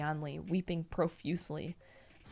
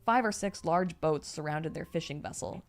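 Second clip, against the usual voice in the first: plain reading voice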